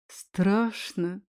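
ordinary delivery, nothing marked

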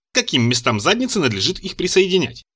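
usual speaking voice

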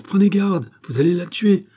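very high, loud warning; alarmed cry. A narrator's reading. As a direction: cry out in alarm for her life. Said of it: "s'écria Louis seize".

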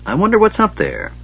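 high, lifted wondering voice; high and curious; wistful wondering. A narrator's reading. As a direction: wonder it aloud, pitched well up. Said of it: "staring up at it wistfully".

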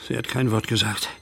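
ordinary delivery

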